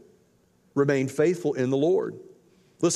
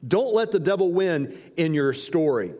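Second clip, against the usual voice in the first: ordinary talk, nothing marked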